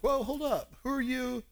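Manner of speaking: high-pitched, acted surprise; taken aback. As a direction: pull up short, voicing a startled woman. Samaritan woman startled by Jesus' request.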